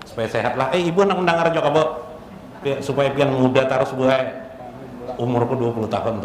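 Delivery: normal talking voice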